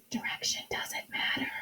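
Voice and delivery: hushed whispered aside; very soft, voiceless whisper. Real, not acted. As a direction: whisper it close to the mic. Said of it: "if I'm whispering".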